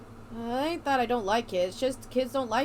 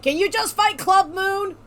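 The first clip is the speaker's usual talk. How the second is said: impatient demand; 7 semitones higher, 8 dB louder than usual. Retold or imitated. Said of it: "people are gonna be like".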